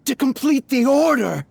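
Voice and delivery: loud, high, pleading cry; frantic loud protest; desperate outburst. Acted. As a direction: blurt it out to win him back. Pleading with master.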